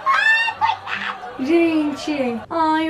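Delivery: high squeal of laughter and pity; real laughing squeal, very high; laughing shriek. Real, not acted. Laughing shriek at his reply.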